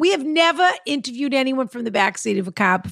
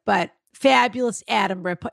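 ordinary talk, nothing marked

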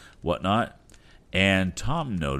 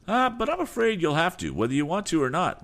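high, sneering rival voice; insolent reply; 4 dB louder than usual. Acted. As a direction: taunt him with insolence. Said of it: "was the insolent reply".

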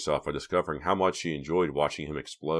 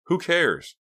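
high, louder scoff; dismissive; 13 semitones higher, 5 dB louder than usual. A narrator's reading. Reva dismisses her own doubt, scoffing. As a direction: toss the thought away sharply. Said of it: "She dismissed it quickly".

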